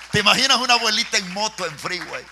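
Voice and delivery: loud, high, amused retelling; laughing while talking. Real, not acted. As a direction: say it through laughter. Repeating joke while laughing.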